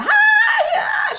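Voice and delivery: very high, loud mock shriek; wordless hysterical scream, retold; imitated scream. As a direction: screech like a sister screaming and crying. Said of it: "She's screaming and she crying".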